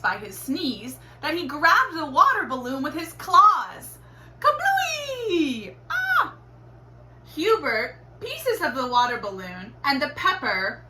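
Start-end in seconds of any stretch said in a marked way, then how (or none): 4.4-5.7 kablooey. A narrator's reading. Balloon bursts. 5.9-6.3 startled cry. A narrator's reading.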